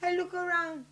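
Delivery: usual speaking voice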